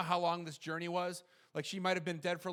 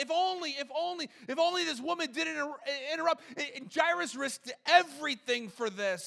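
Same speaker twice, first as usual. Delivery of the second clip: high, loud sermon delivery; pitched up and forceful; impassioned preaching; 10 semitones higher, 6 dB louder than usual. Acted. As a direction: preach it with raised, urgent emphasis. Preaches with raised intensity.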